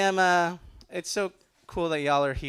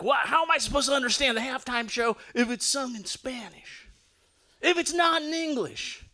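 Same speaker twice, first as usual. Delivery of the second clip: higher, whiny mock outrage; 8 semitones higher than usual. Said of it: "They're like".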